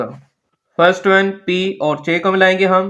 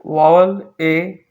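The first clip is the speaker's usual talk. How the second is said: slow title announcement; much slower than usual. Shown as a demonstration. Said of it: "announcing lesson topic".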